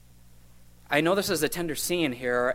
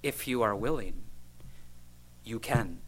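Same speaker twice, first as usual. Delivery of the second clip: softer, humble plea; leper's humble words; 2 semitones lower, 8 dB quieter than usual. Shown as a demonstration. Preacher quoting the leper's plea to Jesus, soft and trusting. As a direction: offer the words in quiet trust. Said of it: "what did he say".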